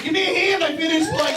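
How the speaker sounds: high, elated call for applause; jubilant, high-pitched appeal to the crowd; excited self-congratulation. Real, not acted. Begs applause for finishing on time.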